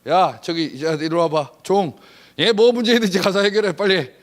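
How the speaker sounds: loud, brisk command to the servant; husband commands the servant, loud and quick; ordering a servant. Acted. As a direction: snap orders at the help.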